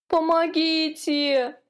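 high, slow, drawn-out whimper. A narrator's reading. Exhausted trapped calf begging for help, a high slow whimper. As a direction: plead weakly like an exhausted little calf. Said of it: "тихонько хныкал".

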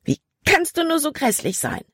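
shrill indignant explosion; exploding outburst. A narrator's reading. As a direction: burst out at him in outrage. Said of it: "explodierte dann".

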